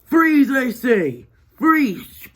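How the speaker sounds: slow, high, loud command; commanding guard. Acted. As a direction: draw it out like a pompous guard. Guard ordering prisoners to stop.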